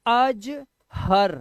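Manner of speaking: अजहर split as अज, then हर; two-part reading. Shown as a demonstration. Teacher showing students how it's read, two-part reading. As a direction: say अजहर slowly in two drawn-out chunks, अज and हर. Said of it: "इसको पता कैसे पढ़ते हैं".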